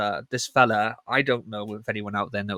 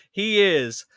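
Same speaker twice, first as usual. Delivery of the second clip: announcer intro; 8 semitones higher, 4 dB louder, much slower than usual. Acted. Announcing the new co-host.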